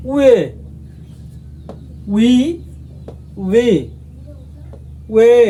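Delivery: each vowel said alone with pauses; slow read-through of the compound vowels; reading Korean vowels. Shown as a demonstration. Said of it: "আমি আবার পড়ে শোনাচ্ছি".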